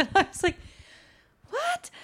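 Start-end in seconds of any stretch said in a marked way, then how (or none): 1.5-1.9 much higher, stunned "What?"; incredulous what. Retold or imitated. Reacting to the captors' dinner invitation, disbelieving squeak. Reacting to an absurd film scene.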